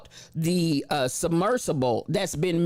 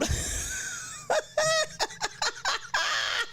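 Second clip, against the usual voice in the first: howling laugh; 5 dB quieter than usual. Real, not acted. Host breaking up at his own joke, howling. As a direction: laugh until it hurts.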